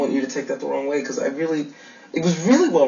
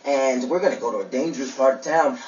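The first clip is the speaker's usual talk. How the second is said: higher-pitched gushing imitation of naive girls; 3 semitones higher than usual. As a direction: mimic an excited newcomer, pitched up.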